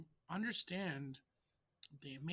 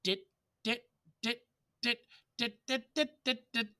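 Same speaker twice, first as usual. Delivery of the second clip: "dit" very short and higher, cut off by the t; tongue each "dit" as short as possible, then speed up the repeats; tiny separated "dit" notes on one raised pitch; staccato; 5 semitones higher, faster than usual. Shown as a demonstration. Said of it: "I think of as dit".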